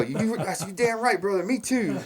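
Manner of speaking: high, hammed-up "damn right"; mock agreement. Farter fakes agreement with the angry stranger, high and hammed-up. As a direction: play along with the angry man, innocently. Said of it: "And I go".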